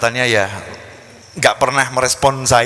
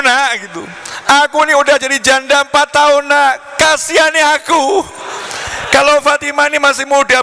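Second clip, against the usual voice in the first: pleading widow; 11 semitones higher than usual. Retold or imitated. Widow mother begs the young man.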